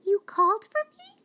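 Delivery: high, quick mouse question; mouse asking. Acted. Mouse arriving to answer the lion's call, high and quick. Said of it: "she asked".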